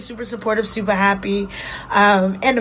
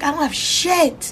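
higher, drawn-out disbelief; stunned despair; 7 semitones higher, slower than usual. Retold or imitated. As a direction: replay the moment it all sank in. Retelling breakdown crying in car.

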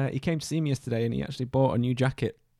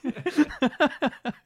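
silly high squeak; 6 dB louder than usual. Pretend. Silly sound effect.

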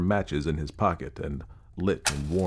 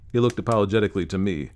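plain reading voice

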